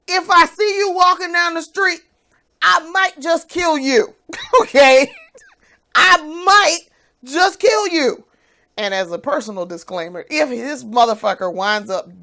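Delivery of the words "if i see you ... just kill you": mock threat. Real, not acted.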